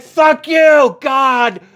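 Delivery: high, loud mock-furious yell; furious shout at God. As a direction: curse the sky as the ticket blows away. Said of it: "And I'm like".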